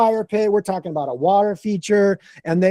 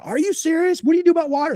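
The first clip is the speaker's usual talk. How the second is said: much higher, astonished question; the client can't believe it; amazed disbelief at the idea; surprised; 11 semitones higher, faster than usual. Retold or imitated. Client stunned by fire pit idea.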